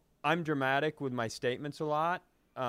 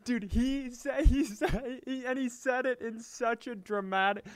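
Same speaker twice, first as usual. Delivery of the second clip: much higher, squeaky laugh-talk; laughing while talking; 11 semitones higher than usual. Real, not acted. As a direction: speak through a fit of laughter. Laughing at the story.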